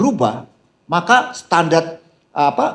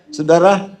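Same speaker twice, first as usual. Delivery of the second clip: ordinary delivery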